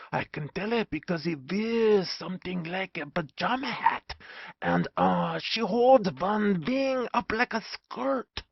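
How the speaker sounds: a hint of Dutch accent, hushed; lightly voiced Dutch professor explaining; whispering Dutch professor. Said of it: "whispered the Dutch professor".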